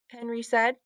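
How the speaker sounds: ordinary delivery, nothing marked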